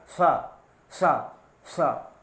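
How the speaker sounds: "सा" said several times with the tongue curled back and the cheeks puffed; retroflex sa demo. Shown as a demonstration. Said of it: "बहुत ज़्यादा ध्यान दीजिएगा". (गाल फुलाकर मूर्धन्य "सा" का उच्चारण)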